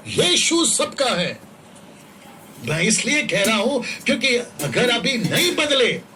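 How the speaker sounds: loud, insistent street-preacher delivery; fervent sermon, pushed loud; full-force preaching. Real, not acted. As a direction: preach it hard, driving each warning home.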